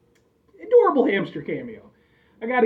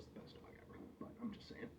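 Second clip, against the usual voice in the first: soft confiding whisper; whispered aside; 4 semitones higher, 29 dB quieter than usual. Real, not acted. Owner whispering a loyal aside about his old dog. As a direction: tuck it away as a secret aside.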